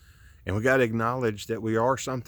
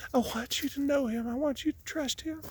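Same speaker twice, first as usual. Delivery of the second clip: emotional; 3 dB quieter than usual. Real, not acted.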